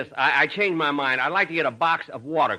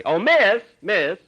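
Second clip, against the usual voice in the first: louder, higher call across the shop; louder, hailing the clerk who has walked off; 9 semitones higher, 8 dB louder, slower than usual. Acted. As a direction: call her back from across the counter. Calling the clerk back.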